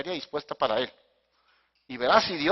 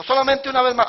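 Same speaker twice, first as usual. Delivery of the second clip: high, loud mimicked begging; mock plea; 9 semitones higher, 11 dB louder than usual. Mimicking Samson's one small request, loud high plea. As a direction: throw the quote out high and loud.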